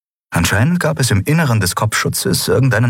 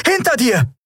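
urgent warning. A narrator's reading. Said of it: "rief Lien".

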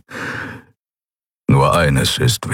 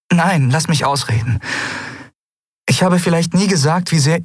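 put-on higher, urgent young woman's voice; Andriel insisting; 11 semitones higher than usual. Andriel to Kai, refusing to be cut off, high urgent plea. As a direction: push past his interruption, pitched high.

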